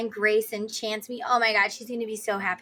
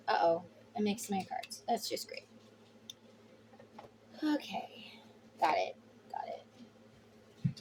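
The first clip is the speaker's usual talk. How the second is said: whispered to herself; 2 semitones lower, 10 dB quieter than usual. Real, not acted. Fixing mixed cards, to herself.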